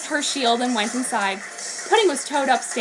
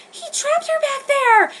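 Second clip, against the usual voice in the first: put-on very high, slow girl's voice; 3 dB louder, slower than usual. Lettuce tells Rio where Pudding is, high and slow. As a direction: point and say it anxiously. Pointing to the rocks.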